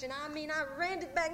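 ordinary delivery, nothing marked

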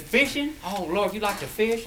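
eager southern drawl. Retold or imitated. Carolina man excited about fishing.

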